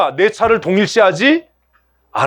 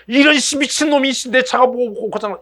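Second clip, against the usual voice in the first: mock furious car owner, pitched up; angry outburst; 6 semitones higher than usual. Car owner snapping at the mechanic, mock angry. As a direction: sputter at the mechanic in mock rage. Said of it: "막 화내지, 신경질 내고".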